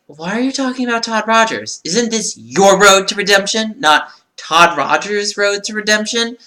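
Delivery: high-pitched, exasperated disbelief; incredulous questioning. Retold or imitated. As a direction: act out baffled protest, pitched well up. Acts out own baffled reaction.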